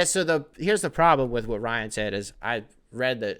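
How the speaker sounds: normal talking voice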